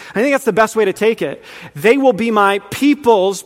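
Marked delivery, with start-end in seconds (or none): 1.8-3.4 slow, with "peoples" set apart and stressed. Shown as a demonstration. Preacher showing the plural variant, stress on "peoples". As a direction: spell out the plural by leaning on "peoples".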